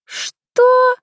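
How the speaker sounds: loud, very high-pitched gasp of a question; stunned, leaping upward; shocked. A narrator's reading. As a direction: squeak it out in disbelief. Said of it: "в шоке смотрела бывшая подруга".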